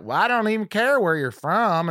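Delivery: high, hammed-up Southern twang; mock an overdone Southern accent; exaggerated Southern accent. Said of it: "She does this Southern accent".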